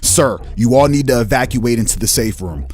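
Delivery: loud, hurried alarm; urgent shout. Acted. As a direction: bark the evacuation order. Guard bursts in, urgent warning.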